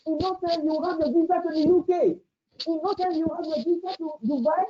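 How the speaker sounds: hammed-up pastor promising visas; imitating a preacher.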